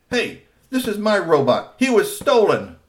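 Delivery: high, loud protest; claiming his robot back; indignant kid. A narrator's reading. Three T protesting to Kusumono, indignant. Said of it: "Three T said".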